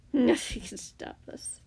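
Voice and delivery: slow, drawn-out sneer. A narrator's reading. The captor scoffs at being stopped, sneering. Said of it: "the man sneered".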